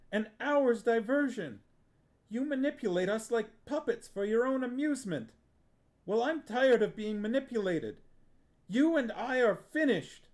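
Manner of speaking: put-on a boy pouring out years of resentment at his aunt; furious tirade. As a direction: let the anger run away with you.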